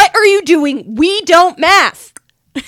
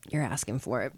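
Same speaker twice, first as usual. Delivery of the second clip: low mock threat; 14 semitones lower, 18 dB quieter than usual.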